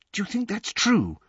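high, hushed worried question; alarmed whisper. A narrator's reading. Hermione asks Harry and Ron, in hushed alarm. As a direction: ask anxiously, voice pitched right up. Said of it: "Hermione whispered in alarm".

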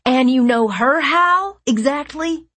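pin her down, incredulous; demanding. A narrator's reading. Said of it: "Liam demanded".

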